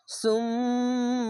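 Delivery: slow, long-drawn chanted syllables; tajweed recitation. Shown as a demonstration. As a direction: stretch every sound in recitation. Recites the word.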